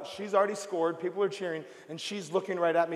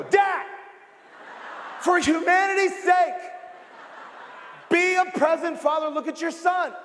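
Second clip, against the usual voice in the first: exasperated shout; 9 semitones higher, 3 dB louder than usual. Retold or imitated. The preacher yelling at a distracted father at check-in, loud exasperated plea. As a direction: yell at him to wake up and look at his son. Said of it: "And then I go".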